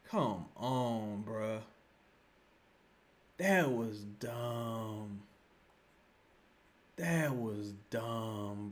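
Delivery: slow, low, deflated voice; drained, disappointed lament; dejected disbelief. Real, not acted. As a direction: drag it out low, as if crushed. Deflated after the bad foul.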